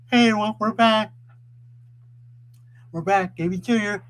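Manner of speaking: high, loud announcement of a return; calling out. Real, not acted. Streamer telling everyone we're back, loud excited hail. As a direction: hail the whole audience loudly.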